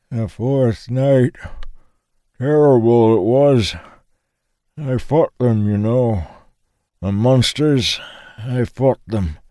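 put-on high, slow, frail old veteran remembering; old man voice. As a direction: voice a frail old soldier lost in memory. Old man recalls the war.

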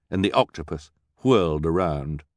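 ordinary delivery, nothing marked

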